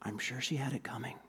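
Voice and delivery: confidential stage whisper; hushed, voiceless aside. A narrator's reading. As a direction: lean in and whisper it. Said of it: "the ambassador leaned over and whispered".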